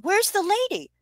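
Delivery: put-on high curious child; a small girl asking; little girl voice. A narrator's reading. Girl Casey looks around.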